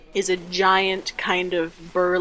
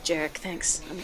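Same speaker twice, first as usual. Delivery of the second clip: soft, halting stammer; small, nervous voice trying to stay out of it; 10 dB quieter than usual. Acted. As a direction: back out timidly with hesitant repeats. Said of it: "he looks real, real nervous".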